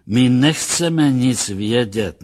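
displeased objection. A narrator's reading. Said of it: "namítl kapitán s nelibostí". (pomalá nelibá námitka)

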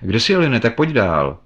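high, loud searching call. A narrator's reading. Thompson looks for his friend from the door, calling loudly. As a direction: call out into the dark, pitch falling at the end.

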